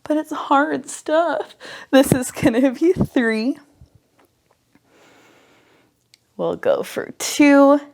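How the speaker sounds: labored breathing, slow strained counting. Real, not acted. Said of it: "Excuse my labored breathing".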